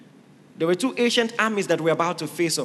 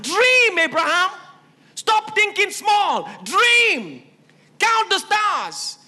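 high loud ringing call to dream; God urging Abraham under the stars, loud and soaring; 8 semitones higher, 3 dB louder than usual. Acted. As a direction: lift Abraham's eyes to the stars.

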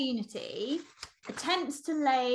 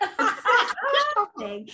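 slow, loud, higher, laughing; amused disbelief, louder and slower; laughing emphasis; 7 semitones higher, 6 dB louder than usual. Real, not acted. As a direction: lean on it through laughter.

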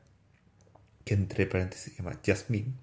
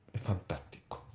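whisper; 2 semitones lower, 7 dB quieter than usual. Real, not acted. Closing his testimony moment.

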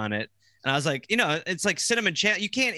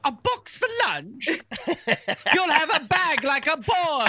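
put-on high, scornful English accent; high scoffing English mother; mother's English accent; 12 semitones higher than usual. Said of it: "She was like".

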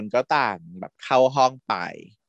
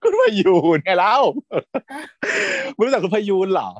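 higher, louder disbelief; incredulous; 8 semitones higher, 4 dB louder than usual. Real, not acted. Host incredulous that co-host doesn't know Khun Payoon. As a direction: act shocked they don't know her.

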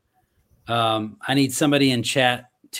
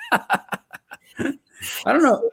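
laughing; 12 semitones higher, 3 dB louder, much slower than usual. Real, not acted. Laughing after the winner is drawn.

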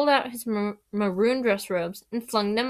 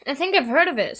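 higher, louder vague recollection; half remember it; lightly uncertain boy's voice; 5 semitones higher, 5 dB louder, faster than usual. A narrator's reading. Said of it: "said Ron vaguely".

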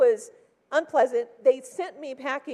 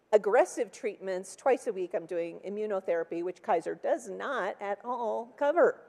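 ordinary delivery

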